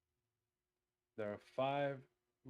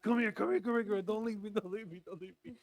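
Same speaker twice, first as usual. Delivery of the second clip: high, loud mock desperate begging; cling and beg her to stay; hammed-up pleading; 9 semitones higher, 6 dB louder, faster than usual. Woman acting out begging her girlfriend not to leave, high and hammed-up. Begging partner to come back.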